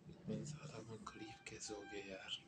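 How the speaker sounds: hushed whisper of disbelief; whispered lament. Acted. Vlogger wondering aloud how he got so poor, whispering. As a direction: whisper it like a secret regret.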